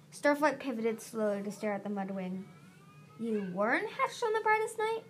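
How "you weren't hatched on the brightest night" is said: high, incredulous question; stunned echo. A narrator's reading. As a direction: repeat it back in high-pitched disbelief. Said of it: "he echoed".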